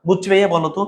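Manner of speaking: ordinary delivery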